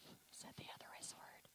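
confidential whisper, barely audible; whispered aside. Real, not acted. Preacher confessing a bad word to congregation, whispered. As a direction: whisper it like a naughty admission.

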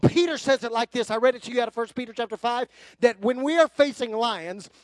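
ordinary delivery

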